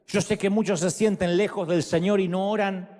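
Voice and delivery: usual speaking voice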